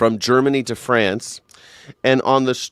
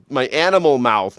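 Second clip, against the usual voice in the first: ordinary delivery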